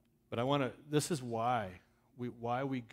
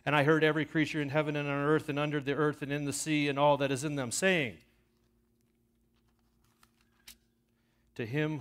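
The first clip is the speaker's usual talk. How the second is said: loud, ringing Bible reading, rising in force; scripture reading; 4 semitones higher, 12 dB louder than usual. Acted. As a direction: proclaim the text to the whole congregation.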